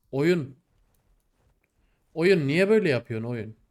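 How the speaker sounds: loud, frustrated complaint; angry at the game. Real, not acted. Gamer scolding the game that keeps killing him, angry. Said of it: "Gerçekten sinirleniyorum oyun".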